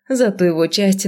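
ordinary delivery

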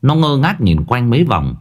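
plain reading voice

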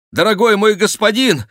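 high, loud joyful call; exclaiming. A narrator's reading. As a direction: burst out high and loud to a friend. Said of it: "воскликнул очень знакомый голос". (громкий высокий возглас)

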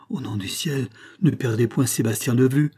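usual speaking voice

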